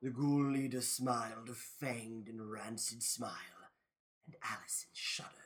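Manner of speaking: low, very soft narration; hushed dread. A narrator's reading. Narrator as Allison shudders at the ghoul, hushed. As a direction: let it sink to near whisper.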